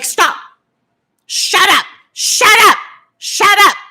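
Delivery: high, loud mock yell of shut up; mock shout. Mimicking the YouTuber HRH to viewers, hammed-up shout. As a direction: imitate her snapping shut up over and over. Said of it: "She's like".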